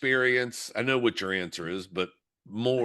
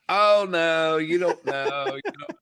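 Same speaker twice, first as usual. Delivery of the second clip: mock brush-off, louder and higher at the start; dismissive teen; 6 semitones higher, 5 dB louder than usual. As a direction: wave off a parent's advice. Daughter brushing off warning.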